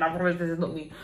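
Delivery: ordinary delivery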